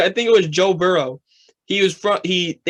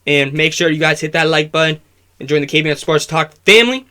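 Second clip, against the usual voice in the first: a bit louder, upbeat sign-off to the viewers; upbeat host outro; 4 dB louder than usual. Acted. Host thanking viewers and guest, upbeat outro. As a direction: wrap up the show like a YouTube host.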